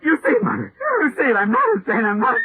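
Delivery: a son crying to his mother that the thing is real; panicked hysteria. Acted. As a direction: beg her to see it, voice cracking. Monster appears, son panics.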